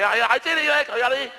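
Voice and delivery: high, loud call to the group; rally the others, hammed-up; caveman calling the others. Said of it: "il y en a un qui a fait".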